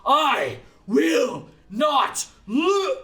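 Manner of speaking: slow, high, loud drawn-out roar; villain roaring defiance as he charges; furious roar. Acted. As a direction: roar it out with everything. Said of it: "he is mustering".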